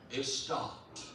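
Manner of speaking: ordinary talk, nothing marked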